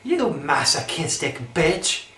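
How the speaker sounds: ordinary delivery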